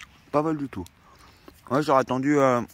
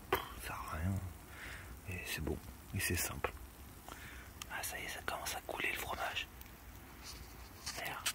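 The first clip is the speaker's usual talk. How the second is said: whispering; 6 semitones lower, 14 dB quieter than usual. Acted. Whispering over the cooking pizza.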